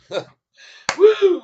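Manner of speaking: whoop. Real, not acted. Cheering after breathless singing.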